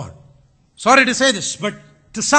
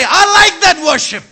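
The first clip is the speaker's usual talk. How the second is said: God pleased; 5 semitones higher, 5 dB louder than usual. Retold or imitated. God speaking from heaven.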